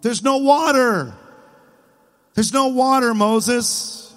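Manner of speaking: high, drawn-out mock whine; put-on pleading whine; whining. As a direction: whine it like a complaining crowd. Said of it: "Can you hear 'em whining?".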